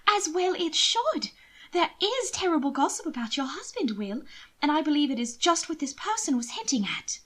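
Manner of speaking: a worried sister pleading. Acted. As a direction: press the danger on him. Mina urging caution.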